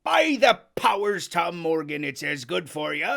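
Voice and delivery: loud hearty sailor; exclaiming. Acted. Landlord exclaims at Morgan, loud. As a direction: burst out with it. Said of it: "exclaimed the landlord".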